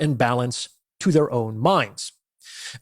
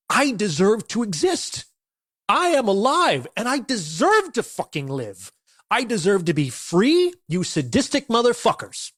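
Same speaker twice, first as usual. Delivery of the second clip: higher-pitched, defiant declaration; with conviction; 5 semitones higher than usual. Shown as a demonstration. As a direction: stand up to your jailers and really mean it. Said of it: "you have to say it with conviction".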